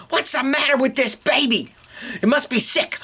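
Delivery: high-pitched alarmed complaint; exasperated principal. Acted. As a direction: throw it out at your wits' end. Principal exasperated by screaming baby.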